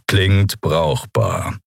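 slow character growl. A narrator's reading. Keru cuts in on Kai's sales talk, growling slowly. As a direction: growl it out, drawing the words long. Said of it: "knurrte Keru".

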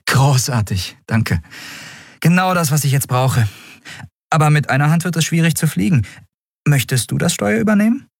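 thankful, then invitingly lifting to a question; friendly captain. Acted. Captain thanks the boy and offers him the helm, high and inviting.

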